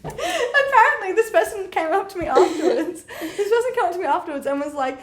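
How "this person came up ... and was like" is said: normal talking voice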